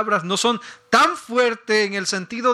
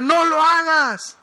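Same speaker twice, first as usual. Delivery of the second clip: higher, louder, slow plea; pleading shout; 9 semitones higher, 3 dB louder, slower than usual. Retold or imitated. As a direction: cry out to stop her jumping.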